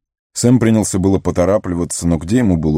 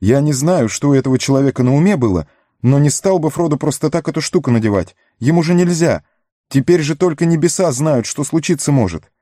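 high, fast worried hobbit; anxious Sam; 9 semitones higher than usual. Acted. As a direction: voice high with alarm. Sam's alarmed speech, pitch high.

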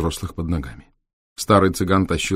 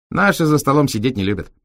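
cheeky raised voice of a Roma driver; 7 semitones higher, 3 dB louder than usual. Acted. Реплика Витька. (бойкий голос цыгана-шофёра)

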